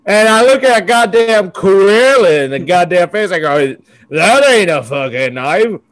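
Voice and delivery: loud hammed-up Aussie; mock Crocodile Dundee. Host quoting Crocodile Dundee in loud mock Aussie accent. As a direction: deliver the knife line like Dundee. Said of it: "That accent change".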